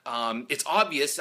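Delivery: ordinary talk, nothing marked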